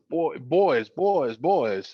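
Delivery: lightly imitated North Carolina drawl; a hint of a Southern coach; attempted Southern accent. Coach stopping his players, lightly imitated Southern accent. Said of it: "tried to do my Southern accent".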